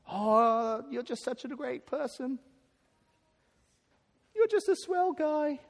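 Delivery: gushing mock American accent, climbing high and slowing. Pastor mocking empty flattery to the congregation, put-on American accent. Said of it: "Notice how I did that in American accent".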